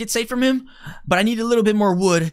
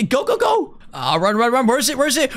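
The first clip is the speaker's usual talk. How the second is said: usual speaking voice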